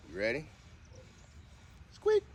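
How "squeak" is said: very high squeaky "squeak", louder; squeaky high command. Shown as a demonstration. Owner coaxing her dog to squeak, very high and squeaky. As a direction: squeak the word like a dog toy. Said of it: "trying to get her to do a squeak".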